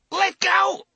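shrill breathless scream. A narrator's reading. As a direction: scream in pain, high and loud. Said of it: "the old bastard screamed breathlessly".

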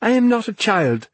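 high-pitched indignant outburst; angry. A narrator's reading. Naomi objects to Ben calling her child, angry. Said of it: "she said angrily".

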